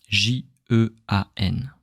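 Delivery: ordinary talk, nothing marked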